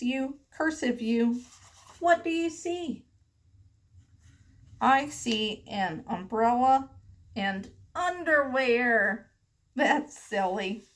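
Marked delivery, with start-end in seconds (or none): none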